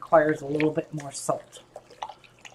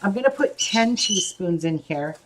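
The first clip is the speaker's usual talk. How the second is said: ordinary talk, nothing marked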